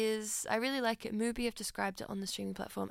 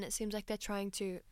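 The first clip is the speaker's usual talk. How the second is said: ordinary talk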